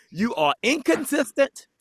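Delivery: high, slow pronouncement; outspoken shopper. Retold or imitated. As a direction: imitate her handing down a verdict. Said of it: "she was not quiet".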